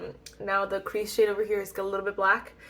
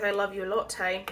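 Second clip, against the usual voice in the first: slipping into a bad British accent; a touch of accidental British accent; 6 dB quieter than usual. Real, not acted. Vlogger naming an eyeshadow shade, slipping into a British accent. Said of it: "always go into a British accent".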